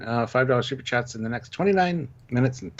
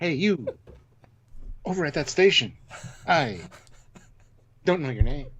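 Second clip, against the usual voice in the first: higher voice, halting between phrases; dramatic-pause captain; 5 semitones higher than usual. Acted. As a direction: parody a captain who pauses mid-sentence. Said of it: "dramatic pauses in his speech".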